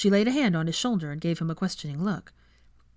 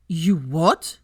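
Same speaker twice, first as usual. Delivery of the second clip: loud disbelieving blurt; stunned, too loud for the hall; shocked outburst; 6 dB louder than usual. Acted. Draco blurting in shock at the table.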